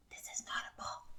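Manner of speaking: soft secret whisper. Real, not acted. Teacher whispering to the class about the box. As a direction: hush it like a secret.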